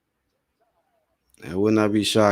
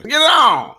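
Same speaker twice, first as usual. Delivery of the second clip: Mills Lane; 5 dB louder than usual. Retold or imitated. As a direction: belt it like the famous boxing referee. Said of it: "My Mills Lane boy".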